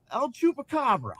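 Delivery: high, loud ring announcement; Laughing Man yelling. Acted. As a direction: yell the fighter's name as the villain boss. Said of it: "He yelled".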